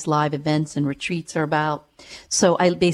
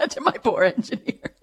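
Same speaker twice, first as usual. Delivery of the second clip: giggling words, pitched up; laughing, higher; 4 semitones higher than usual. Real, not acted. Laughs at own joke.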